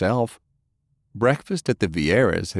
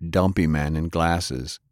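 usual speaking voice

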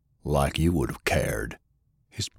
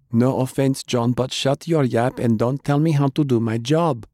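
higher, brisk, unbothered scolding; calm but tart Jane; 6 semitones higher than usual. A narrator's reading. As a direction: cut him off coolly while working. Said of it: "Jane responded calmly".

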